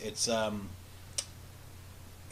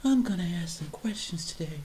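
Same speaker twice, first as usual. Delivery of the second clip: higher, louder self-pep talk; higher nervous pep talk before asking; nervous eager attendee; 6 semitones higher, 4 dB louder than usual. Retold or imitated. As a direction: psych yourself up like a shy guy. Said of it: "that's him trying to assert himself".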